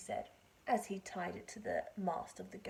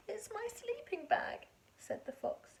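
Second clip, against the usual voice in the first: crying fox; 9 semitones higher than usual. Acted. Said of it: "a fox who was crying".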